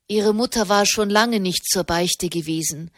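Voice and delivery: ordinary delivery, nothing marked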